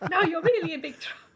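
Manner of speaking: loud, fast, laughing cop warning; cop laughing threat. Retold or imitated. Retelling a movie cop threatening the thief, loud and laughing. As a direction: bark it out half-laughing. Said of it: "the cops were like".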